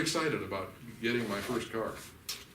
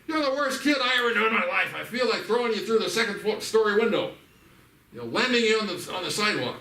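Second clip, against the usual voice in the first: much higher and louder ranting yell; mock furious tirade; 12 semitones higher, 10 dB louder than usual. Angry parent berates his kid, hammed-up shouting. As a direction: rage at the kid in a hammed-up fury. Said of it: "I'm angry at one of the kids".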